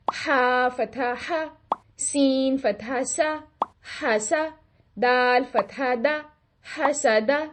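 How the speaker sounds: slow clear "हा सा दा" with open "आ"; correct fatha. Shown as a demonstration. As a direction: spell each letter slowly with a full open "आ". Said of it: "ये तो होगी इसकी दुरुस्त अदायगी". (सही फतहा, खुला मुँह)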